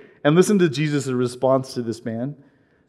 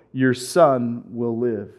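slow solemn promise; 5 semitones lower, 5 dB quieter, slower than usual. Acted. Jesus' word said slowly.

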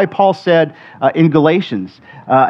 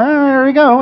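high, goofy, oblivious sheep voice; dumb happy sheep; 10 semitones higher than usual. Acted. Preacher voicing sheep following each other off a cliff, dumb and cheery. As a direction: say it through a dim-witted smile, pitched way up. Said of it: "with this just dumb smile on their face".

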